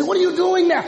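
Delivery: high, loud, laughing mock greeting; preacher voices a friend greeting a returning churchgoer, laughing; imitating old friend. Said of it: "and they say".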